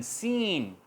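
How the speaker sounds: सीन with the ee stretched long; सीन said as a long seen; long vowel demo. Shown as a demonstration. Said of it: "इसको हम थोड़ा सा long करेंगे".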